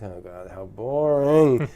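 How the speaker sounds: drawn-out mock boredom; low, slow, bored groan of a line. Giving a verdict on new horror films, bored.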